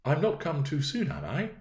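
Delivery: put-on courteous young visitor's voice; sweet young gentleman. A narrator's reading. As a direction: start high and gentle, settle on the tag question. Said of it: "He had a sweet, low manner of speaking".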